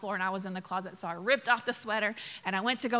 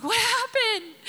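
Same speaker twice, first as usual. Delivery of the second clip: husband's alarmed question, much higher; 12 semitones higher, slower than usual. Retold or imitated. Husband finding his crying wife, high alarmed question. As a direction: squeak it up high in mock alarm.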